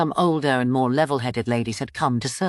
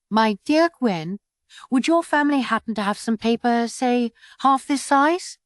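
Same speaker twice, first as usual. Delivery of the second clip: father's request; 8 semitones higher than usual. A narrator's reading. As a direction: ask politely, drawing it out. Father asks the quack.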